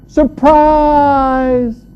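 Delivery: loud, high, drawn-out yell; big, sustained, high-pitched surprise cry; surprise shout. Acted. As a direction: jump out and holler it, stretching the vowel. Son jumps out of car trunk.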